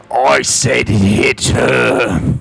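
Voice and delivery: slow, loud barked order; commander's shout. Acted. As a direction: bark the order slowly. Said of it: "The commander looks over".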